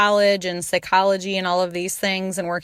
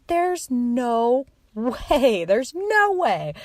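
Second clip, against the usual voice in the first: disbelief; 5 semitones higher, much slower than usual. Retold or imitated. Retelling denial to her husband.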